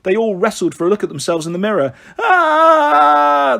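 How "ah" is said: long, high, loud "aah" scream; piercing storybook shriek; hammed-up scream. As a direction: scream in horror, held long. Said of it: "they screamed in unison".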